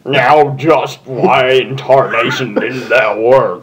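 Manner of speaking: slow, lightly southern drawl; a hint of a southern farm pony, slow; Applejack voice. A narrator's reading. Applejack puzzled the attack failed, slow mild southern drawl. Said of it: "really deep with a slightly southern accent".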